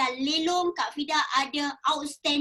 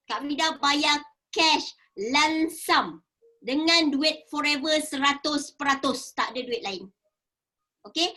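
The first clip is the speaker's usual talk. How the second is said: usual speaking voice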